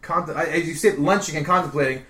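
normal talking voice

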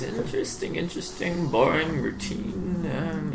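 very soft, absent-minded muttering; hushed in-character musing, barely voiced; murmuring to himself. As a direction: murmur each word as you skim the pages. Character skims book, murmuring to himself.

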